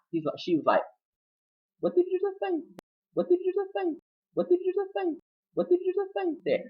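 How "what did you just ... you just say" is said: very high, softened put-on voice; high mocking demand. She questions his plea, high mock voice.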